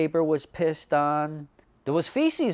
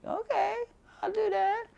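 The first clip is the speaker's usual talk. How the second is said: a very high mock voice of the barber; imitating the barber; 4 dB quieter than usual. Retelling the barber agreeing to do his eyebrows, in a very high mock voice. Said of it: "she was like".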